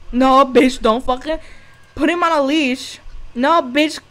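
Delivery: loud scolding yell, high; yelling at dog. Real, not acted. Yelling at the dog on screen, furious. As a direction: scream at the dog to behave.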